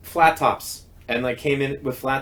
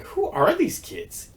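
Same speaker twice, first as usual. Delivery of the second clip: high, slow, awed question; can't believe what he just heard; stunned wonder; slower than usual. Retold or imitated. Hearing one kid cover his friend, slow high awe.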